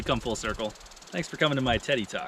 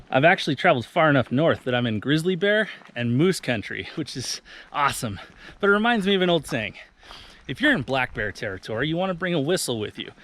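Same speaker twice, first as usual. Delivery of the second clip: ordinary delivery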